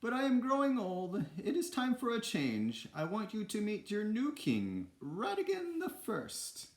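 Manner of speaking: high falsetto queen making an announcement; put-on royal voice, very high, stretching the final name; toy queen. A narrator's reading. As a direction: proclaim it in a hammed-up falsetto. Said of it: "the to-, toy queen went on".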